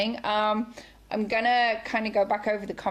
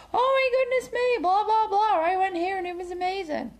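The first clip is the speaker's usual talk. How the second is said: high, gushing rave; raving excitedly; 11 semitones higher than usual. Retold or imitated. Diner imitated raving to friends, high and gushing. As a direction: gush about a great meal. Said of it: "you tell everybody".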